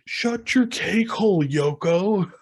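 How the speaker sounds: high, drawn-out mock yell; retold snappy insult. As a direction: imitate a guy telling her off.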